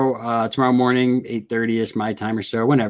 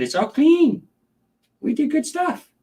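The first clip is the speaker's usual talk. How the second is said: high, sing-song praise for the pet; cooing high praise. Real, not acted. Praising the snake.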